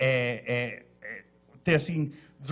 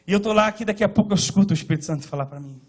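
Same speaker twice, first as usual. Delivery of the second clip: usual speaking voice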